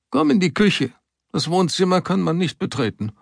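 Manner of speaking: put-on old woman voice. A narrator's reading. Old woman leads him in.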